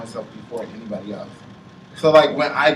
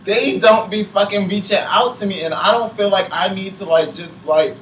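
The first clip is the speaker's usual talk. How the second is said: frustrated venting; 7 semitones higher, 4 dB louder than usual. Real, not acted. Frustrated venting about friends.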